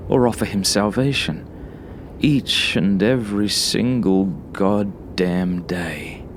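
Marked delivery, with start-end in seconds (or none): none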